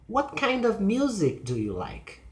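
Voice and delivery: high "What kind", descending to "like"; falling question. Shown as a demonstration. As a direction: information question with stressed "What". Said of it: "falling pitch".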